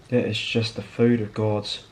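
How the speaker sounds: ordinary talk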